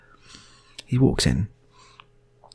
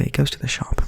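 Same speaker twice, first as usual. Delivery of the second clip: ordinary delivery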